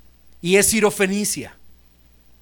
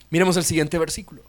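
normal talking voice

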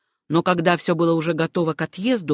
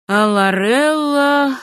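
loud, high, drawn-out challenge; defiant; 7 semitones higher, 6 dB louder, much slower than usual. A narrator's reading. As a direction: stretch the name out like a challenge thrown down. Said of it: "точно бросая кому-то вызов".

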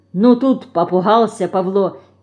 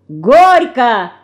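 loud, raised cry; repeated shout; 4 semitones higher, 7 dB louder than usual. A narrator's reading. Лёня shouts it before kissing Федорка, loudly. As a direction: call it out with gusto. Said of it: "крикнул он ещё раз".

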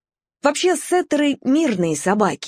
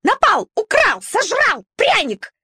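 hysterical squealing; 11 semitones higher than usual. A narrator's reading. Shaiba hysterically accusing the puppy to the other dogs, high squeals. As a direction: squeal each word like a panicked little dog. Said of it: "взвизгнула нервная Шайба".

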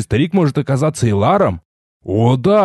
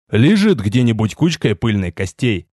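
plain reading voice

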